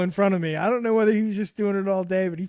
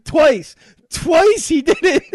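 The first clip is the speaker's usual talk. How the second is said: loud; 12 semitones higher, 12 dB louder, slower than usual. Real, not acted. Outraged retelling of prank.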